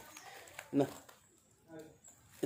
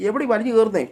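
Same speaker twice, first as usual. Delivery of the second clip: high, raised scolding question; loud scolding; 7 semitones higher, 7 dB louder than usual. Real, not acted. Owner scolding a pet climbing up, loud scolding. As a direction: demand it loudly, annoyed.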